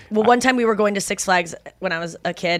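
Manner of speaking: ordinary talk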